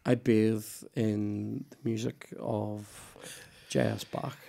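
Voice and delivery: lightly voiced [name], slow and soft; [name] impression. Podcaster mocking his influences as a musician, lightly impersonated [name]. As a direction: do a slow, hushed rock-star impression. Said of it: "That's my best [name] impression".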